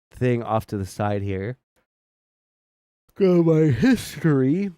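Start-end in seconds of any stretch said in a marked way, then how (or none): 3.2-4.7 talking mid-yawn, "Go to my history". Real, not acted. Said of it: "Sorry, yawning".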